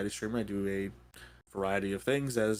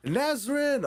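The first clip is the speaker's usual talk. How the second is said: excited name call; 12 semitones higher, 3 dB louder than usual. Real, not acted. Reading another raider name.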